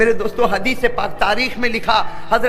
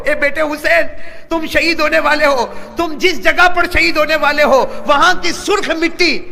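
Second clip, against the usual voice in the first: raised lament; 7 semitones higher, 5 dB louder than usual. Acted. Mother foretells son's martyrdom.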